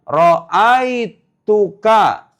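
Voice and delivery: stretched out slowly for the class; drawn-out word, every syllable given; slow classroom model; slow modelled example. Shown as a demonstration. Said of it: "tapi kalau saya bilang".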